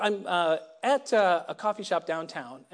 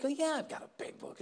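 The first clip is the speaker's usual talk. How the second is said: mock confident bluff; 8 semitones higher, 7 dB quieter than usual. Bluffing reply to questioner.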